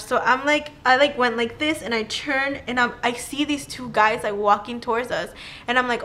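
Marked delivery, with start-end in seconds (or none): none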